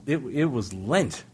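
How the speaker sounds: ordinary talk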